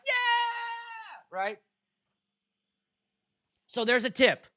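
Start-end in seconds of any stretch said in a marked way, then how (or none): 0.1-1.4 long, high mock cheer; imitated crowd cheer. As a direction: mimic a crowd roaring back to life.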